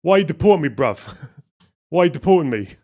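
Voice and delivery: put-on loud, playful London Creole accent; hammed-up Creole street accent; mock Creole accent. Imitating a protester to the immigration officer in Creole accent. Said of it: "I'll do the, uh, Creole accent".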